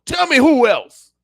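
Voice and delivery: high, loud, slow demand; demanding challenge. Real, not acted. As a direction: call out the crowd, slowly and loudly. Passionate challenge to listeners.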